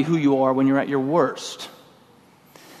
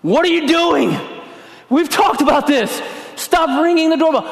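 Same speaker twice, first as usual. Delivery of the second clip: much higher, louder, furious shout; angry yell; 13 semitones higher, 4 dB louder than usual. Retold or imitated. To the little girl ringing the doorbell, furious yelling. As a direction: blow up at the kid on the doorstep. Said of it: "and I'm yelling".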